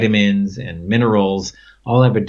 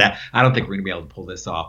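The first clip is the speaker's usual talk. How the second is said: rushed apology; 3 semitones lower, much faster than usual. Retold or imitated. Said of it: "I said".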